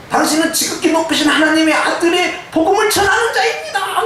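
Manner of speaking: high, laughing spirit voice following Paul; the possessed girl taunting in a put-on voice; mocking spirit. (웃음 섞인 높은 귀신 흉내)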